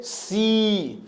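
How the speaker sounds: 'सी' held on a long ee; long sustained ee in 'सी'; drawn-out vowel. Shown as a demonstration. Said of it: "sound को खींच रहा हूं".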